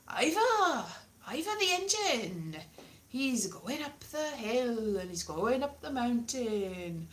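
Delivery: singsong mock-Welsh storybook voice; terrible fake Welsh. Comic parodying Ivor the Engine, terrible Welsh accent. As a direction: stretch it out in a bad Welsh lilt. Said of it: "terrible accents, not at all Welsh".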